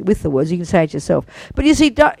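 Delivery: usual speaking voice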